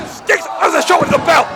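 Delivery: rising to a high shouted call; wrestling commentator. Acted. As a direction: call the finish, climbing to a shout. Live play-by-play call, climbing.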